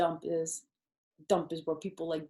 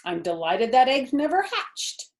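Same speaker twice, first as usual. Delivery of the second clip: gabbling goose; 7 semitones higher, 6 dB louder than usual. Acted. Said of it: "she gabbled".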